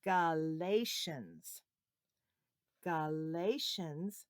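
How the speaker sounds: Galatians slow and clear, teacher model; slow model for repeating. Shown as a demonstration. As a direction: say "Galatians" slowly so children echo.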